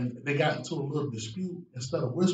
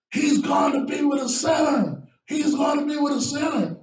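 higher, shouted grumbling crowd; loud complaint; 12 semitones higher, 8 dB louder than usual. Retold or imitated. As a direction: shout the accusation like an angry crowd. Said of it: "They said it loudly".

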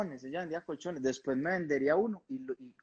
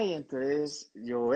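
ordinary talk, nothing marked

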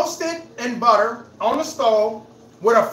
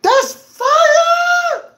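high, loud shout of delight; excited shout; 5 dB louder than usual. Real, not acted. Cook tasting his egg sandwich, shouting with delight. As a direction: yell it out like the food is amazing. Tasting food, live reaction.

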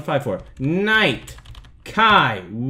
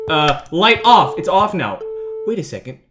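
ordinary talk, nothing marked

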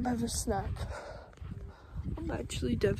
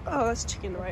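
ordinary talk, nothing marked

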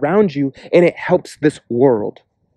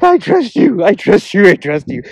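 crying out; 6 semitones higher, 3 dB louder than usual. Retold or imitated. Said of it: "crying out".

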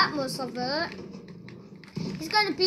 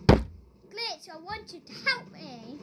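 ordinary delivery

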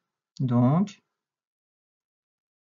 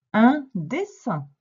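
slow, louder and higher word presentation; emphatic dictation; 4 semitones higher, 6 dB louder, slower than usual. Shown as a demonstration. Teacher giving a pupil the next word, emphatic dictation. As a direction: announce it slowly and firmly for a learner.

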